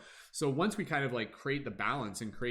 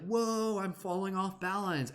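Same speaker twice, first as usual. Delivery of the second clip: higher, alarmed wobble; startled whoa; 7 semitones higher, slower than usual. Acted. You losing balance, higher startled whoa. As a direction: yelp as you tip over. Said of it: "you're gonna be like".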